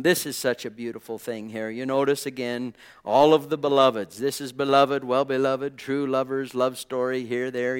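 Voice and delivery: usual speaking voice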